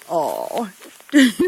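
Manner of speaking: ordinary delivery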